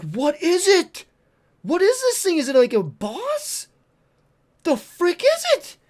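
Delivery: high, loud incredulous questioning; drawn-out, high-pitched disbelief; acted bewilderment. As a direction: re-enact baffled amazement, high and loud. Acting out bewildered reaction to dragon.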